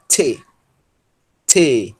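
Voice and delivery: "T" said as "tê" with a puff of air; aspirated "tê" for "T"; blown T. Shown as a demonstration. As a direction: release the T of "T" with audible breath. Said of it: "Quem pronunciar o T soprado".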